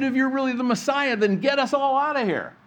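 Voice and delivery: the reviling criminal sneering from his cross; reviling taunt. Acted. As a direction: jeer at him to prove himself. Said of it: "this one who was reviling Christ".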